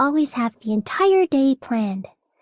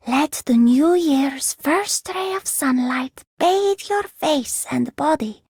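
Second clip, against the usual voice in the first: ordinary talk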